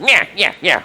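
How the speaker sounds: high squeaky goblin chatter; goblin noise. Retold or imitated. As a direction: yip it like a little goblin. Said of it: "like that".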